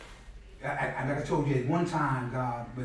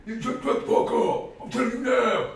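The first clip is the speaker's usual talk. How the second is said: mock stern order; 9 semitones higher, 4 dB louder than usual. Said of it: "You telling me?".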